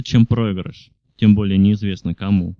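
ordinary talk, nothing marked

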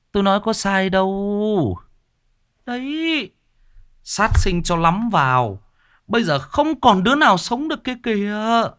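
high, sharp villager voice; gossiping villager. A narrator's reading. Villager gossiping about the curse, high and pointed.

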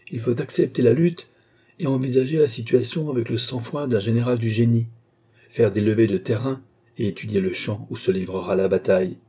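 usual speaking voice

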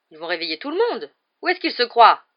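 loud, rising outrage; indignant. A narrator's reading. Matron angry at noise waking everyone, indignant. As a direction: climb to a high, loud protest.

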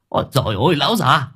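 high, loud, fast indignant ranting; cursing. Acted. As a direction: spit out the curses in disgust. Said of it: "tiếng chửi".

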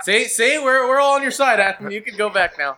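high, hurried reassurance; anxious placating. Pretend. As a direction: placate a difficult caller to send him away. Placating caller to get him off.

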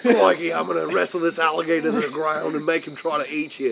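joking about an Australian Bond villain, hammed-up Australian accent; Steve Irwin impression. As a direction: play a Crocodile Hunter Bond villain, loud and gleeful. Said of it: "Steve Irwin could not have been Doctor No".

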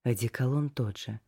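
ordinary delivery, nothing marked

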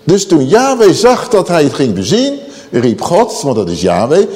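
usual speaking voice

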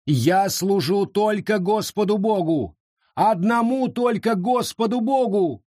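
high, insistent proclamation. A narrator's reading. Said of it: "Возвысив голос".

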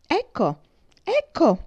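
high, loud, triumphant shouting; ecstatic cry. A narrator's reading. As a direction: crow it out in delight. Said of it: "gridò Mary in estasi". (grido estatico, acuto e forte)

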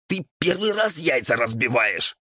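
high, raspy ghoul outburst; exasperated scolding. A narrator's reading. Ghoul scolds devil over broken eggs, exasperated. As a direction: scold him in a strained high rasp.

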